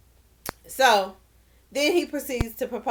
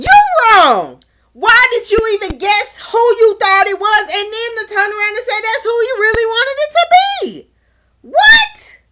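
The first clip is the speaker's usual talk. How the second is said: loud, high tirade climbing to a shriek; outraged rebuke; 9 semitones higher, 8 dB louder than usual. Pretend. Recapper blasting Shane for his confession, loud and outraged. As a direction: yell it in total disbelief.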